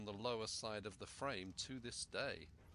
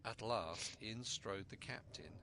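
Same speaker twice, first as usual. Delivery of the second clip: ordinary delivery, nothing marked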